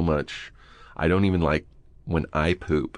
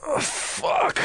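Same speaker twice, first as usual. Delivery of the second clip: airy, groaned curse; acted effort of standing up; strained getting-up curse. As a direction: groan it out while heaving yourself up. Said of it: "as I'm standing up, I go".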